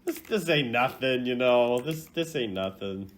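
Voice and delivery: cocky shrug at a beating; tough-guy bravado. Pretend. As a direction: act like the hits don't hurt. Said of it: "'cause it's like".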